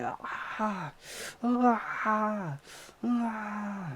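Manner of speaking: high mock screaming ah; retold scream. Attacker twitching in cuffs, screaming in a high mock voice. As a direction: imitate a twitching man crying out in high-pitched ahs. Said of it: "And he's like".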